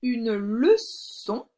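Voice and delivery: Une leçon stretched into une le-çon; slow sounding-out. Shown as a demonstration. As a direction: say Une leçon very slowly, syllable by syllable. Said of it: "je te donne la réponse pour la première".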